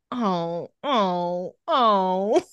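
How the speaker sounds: drawn-out falling groans of secondhand embarrassment; mock pained cringe. Viewer watching a bad accent attempt, mock pained cringe. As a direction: wince in sympathy, swelling on each oh. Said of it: "it's like".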